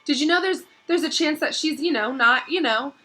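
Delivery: high, halting fear; can't bring himself to say it; hesitant dread. A narrator's reading. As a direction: Ron dreading the worst. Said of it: "said Ron".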